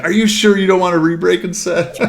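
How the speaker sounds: high-pitched imitation of an eager intern; fired up. Pilot imitating the intern who wanted to re-break his hand, fired up. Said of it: "He was so fired up".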